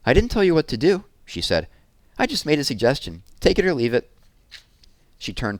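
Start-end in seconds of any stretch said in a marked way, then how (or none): 0.1-1.0 put-on higher and louder, offended girl; indignant young girl. A narrator's reading. Sister retorts in read story. 2.2-4.1 put-on higher girl's voice, dismissive; haughty young girl. A narrator's reading. Sister retorts in read story.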